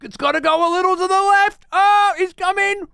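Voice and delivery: high, loud excited presenter; hammed-up game show host. Mocking the quiz host cheering the coin, high and loud. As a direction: hype the coin drop like a TV host. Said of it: "the host presenter's getting into it".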